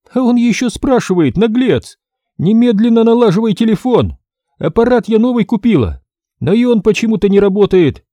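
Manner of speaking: высокий голос, громче; отчитай наглеца; сердитая женщина. Acted. Женщина ругается. (возмущённая хозяйка требует починить телефон)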